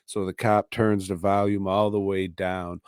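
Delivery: ordinary talk, nothing marked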